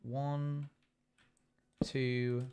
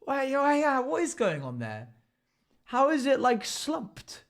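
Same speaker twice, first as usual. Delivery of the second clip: high, baffled double take; puzzled "wait, what?" voice; confused surprise; 12 semitones higher, 4 dB louder than usual. Retold or imitated. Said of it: "you're like".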